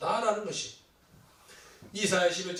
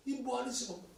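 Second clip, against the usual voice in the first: raised pitch, restrained scolding; retold indignant yell; 6 semitones higher, 4 dB quieter than usual. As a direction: imitate an outraged challenge without full volume. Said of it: "막 소리를 질렀어요".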